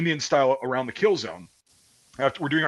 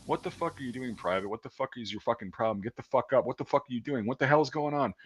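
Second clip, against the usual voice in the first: soft, distant-sounding dressing-down; muted drill sergeant; 7 dB quieter than usual. Retold or imitated. Drill sergeant to a private just waking up, muted. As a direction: scream turned down to a hushed, even rant. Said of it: "sounded at the time like he was just going".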